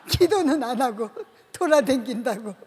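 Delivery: laughing through the words. Real, not acted. Laughing while telling of the director's scolding. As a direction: chuckle while retelling the scolding.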